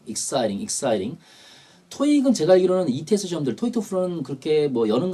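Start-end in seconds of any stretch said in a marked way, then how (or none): 0.1-1.2 exciting said twice, T turned into a quick R-like tap; American flapped T. Shown as a demonstration. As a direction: say "exciting" as "ek-SAI-ring", American style. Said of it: "T가 요즘 미국에서 알 발음하죠". (exciting을 '익사이링'처럼 T를 굴려서)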